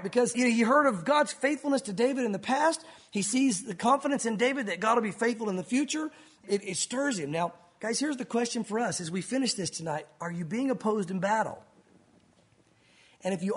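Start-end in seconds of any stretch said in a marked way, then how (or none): none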